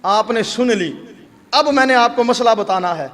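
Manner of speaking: normal talking voice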